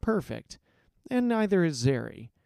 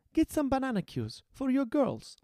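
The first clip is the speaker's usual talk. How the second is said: put-on higher, bright young girl voice; Zeri voiced; 11 semitones higher than usual. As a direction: lift into a young heroine's voice. Zeri's lines.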